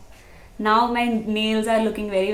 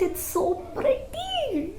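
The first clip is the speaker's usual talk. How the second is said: excited squeal; 5 dB quieter, slower than usual. Real, not acted. Gushing over finished eye look.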